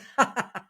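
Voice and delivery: a high, short giggle; mock giggle. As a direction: burst into a giggle. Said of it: "she starts laughing".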